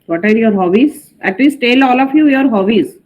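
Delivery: ordinary delivery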